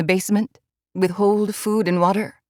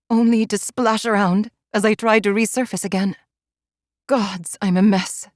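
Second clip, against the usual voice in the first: plain reading voice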